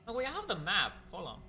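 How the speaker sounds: sudden high, loud realization; excited realization. Real, not acted. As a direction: blurt it out as the idea hits. Suddenly remembers the map.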